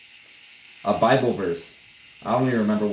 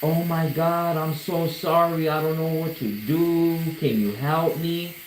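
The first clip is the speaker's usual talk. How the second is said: high, slow mock sobbing plea, higher and slower; imitating her crying; 6 semitones higher, slower than usual. Customer at the door begging the artist for help, mock crying. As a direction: retell her tearful plea in a lightly mocking voice. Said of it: "she's fucking crying her eyes out".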